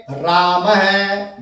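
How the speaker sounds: राम है drawn out as "raa-ma-ha"; visarga echoing A. Shown as a demonstration. Teacher showing how रामः is spoken, slowly ending "ha". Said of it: "बोला ऐसे जाएगा".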